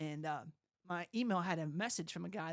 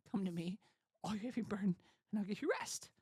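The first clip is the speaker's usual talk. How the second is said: soft recitation while holding back tears; quietly choked-up scripture quote; near tears; 6 dB quieter, faster than usual. Real, not acted. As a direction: quote it gently, really moved. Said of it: "I'll cry, 'cause every time".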